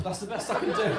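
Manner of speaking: usual speaking voice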